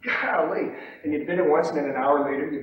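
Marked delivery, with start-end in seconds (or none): 0.0-0.7 sheepish, hushed self-reproach; mock embarrassment. Trader hearing himself bid and cringing, mock embarrassed murmur. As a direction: shrink away from your own voice. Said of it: "you're embarrassed and you go".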